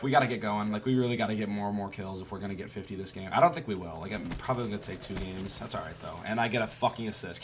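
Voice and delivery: ordinary talk, nothing marked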